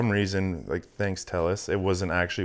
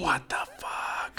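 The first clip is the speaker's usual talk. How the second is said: hushed, slow disbelief; stunned whisper; 7 semitones higher, 9 dB quieter, much slower than usual. Real, not acted. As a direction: mouth it in shock. Stunned reaction.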